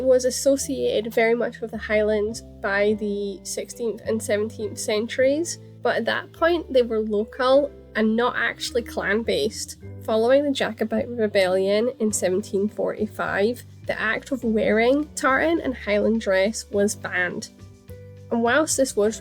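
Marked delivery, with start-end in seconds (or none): none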